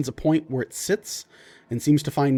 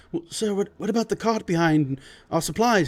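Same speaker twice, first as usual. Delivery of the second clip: timid servant; 6 semitones higher than usual. Acted. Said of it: "trying to ask".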